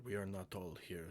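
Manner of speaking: normal talking voice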